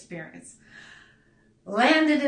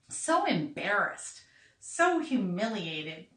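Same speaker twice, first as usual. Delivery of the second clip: ordinary talk, nothing marked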